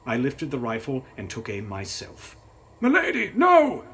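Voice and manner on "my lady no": very high, loud shouted "no"; desperate cry. A narrator's reading. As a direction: cry out to stop her. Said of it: "Mister Stevens cried out".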